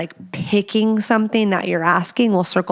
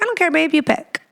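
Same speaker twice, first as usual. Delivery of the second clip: high-pitched dismissive imitation; mocking, higher-voiced husband; mock indecisive husband; 8 semitones higher, faster than usual. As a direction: imitate a passive partner in a raised pitch.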